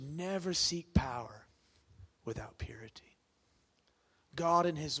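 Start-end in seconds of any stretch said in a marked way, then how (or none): 2.2-3.2 hushed whisper on purity. Shown as a demonstration. Preacher warning the church, hushed whisper. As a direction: drop to a breathy whisper. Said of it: "whispered for emphasis".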